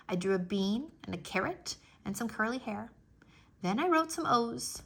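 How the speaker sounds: ordinary delivery, nothing marked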